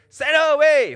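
high shouted demand; begging. Acted. Disciples beg Jesus to send the woman away, begging loudly. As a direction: call it out loudly, urgently begging. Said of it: "the disciples came and begged him".

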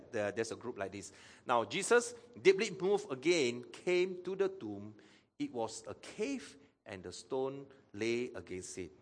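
normal talking voice

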